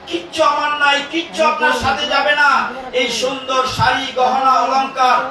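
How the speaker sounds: fast fervent warning. Acted. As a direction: preach it out with urgency. Preacher's raised, fast warning.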